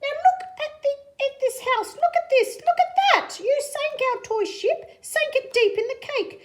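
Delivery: high, loud, scolding fish with a laugh; squeaky outraged scolding; fish character voice. Acted. Fish orders the cat out of the house, high and loud. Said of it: "said the fish in the pot".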